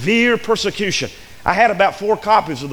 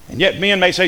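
usual speaking voice

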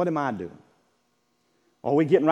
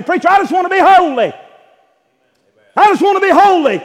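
high, loud pleading cry; earnest plea; 13 semitones higher, 12 dB louder than usual. Retold or imitated. Imagined churchgoer begging the preacher, high loud earnest plea. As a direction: cry out longing to be holy.

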